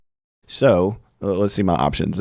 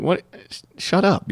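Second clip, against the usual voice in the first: higher, lightly voiced wife snapping back; a hint of the wife telling him to shut up; mock wife; 12 semitones higher than usual. Husband imitating his wife brushing off his advice, mildly mocking.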